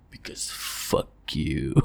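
slow breathy whisper, cruel and deadpan; whispered taunt. Pretend. Captor whispering to the fish, slow and cruel. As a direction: hiss it slowly like a villain.

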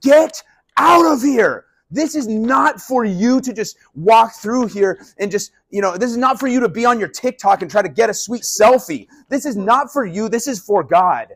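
loud, high, slow and cracking; angry shout. Retold or imitated. Jesus chasing tourists from the temple, in a loud cracking yell. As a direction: yell them out of the holy place. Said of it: "Jesus is like".

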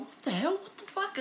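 high, squeaky shocked outburst; recalling the shock of the old photo; stunned disbelief. Retold or imitated. Kid seeing the photo, high shocked outburst. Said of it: "taking this photo and going".

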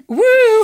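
loud, high comic woo; high-pitched jokey hoot; high whoop. Pretend. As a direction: let out a sudden playful whoop. Joining in a running joke.

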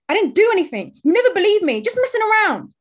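high, loud protest; defensive shouting. Acted. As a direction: yell back in denial. Son defends himself in quarrel.

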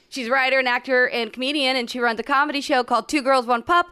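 game show contestant introduction; announcer voice. Pretend. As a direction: build up to the name like a host. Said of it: "I like your announcer voice".

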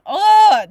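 loud frustrated groan; exasperated groan. Real, not acted. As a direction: let out a loud, irked groan. Irked groan at the character.

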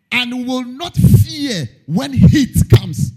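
slow, lifted reading of the verse; scripture reading. Acted. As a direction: read it out like a psalm.

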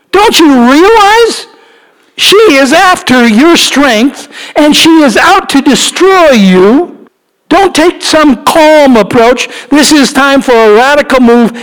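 preaching at full force. Acted. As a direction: plead with Samson from the pulpit. Preaching to Samson.

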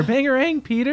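high Bangarang call; movie quote. Retold or imitated. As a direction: call out Bangarang like a Lost Boy. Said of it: "my brain went".

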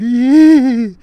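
high, loud gleeful squeal; a sudden happy yelp, way up high; excited squeal. Retold or imitated. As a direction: squeal with delight like SpongeBob. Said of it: "lets out a little, like, squeal".

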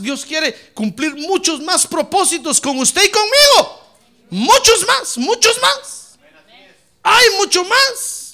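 climbing to high, loud cries; fervent preaching shout. Real, not acted. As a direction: build to an insistent, high-pitched exhortation. Insists God has much more.

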